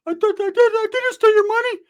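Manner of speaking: put-on very high squeaky kid voice; high loud little-kid plea; kid Ricky. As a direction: squeak it out like an eager little boy. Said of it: "Ricky's like".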